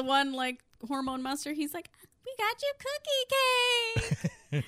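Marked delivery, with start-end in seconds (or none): 2.2-4.6 high, singsong party announcement; cutesy presenting voice. Retold or imitated. Monster offers cake at the surprise party, cutesy presenting voice. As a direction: lilt it high, stretching it out.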